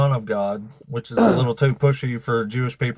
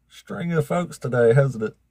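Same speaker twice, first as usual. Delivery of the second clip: ordinary talk, nothing marked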